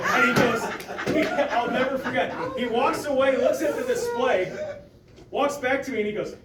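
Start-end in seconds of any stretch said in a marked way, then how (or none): none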